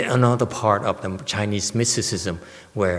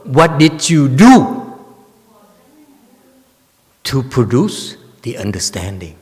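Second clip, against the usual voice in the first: very direct; 3 semitones higher, 6 dB louder than usual. Retold or imitated. Teacher voicing the patriarch's challenge, very direct. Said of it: "very, very direct".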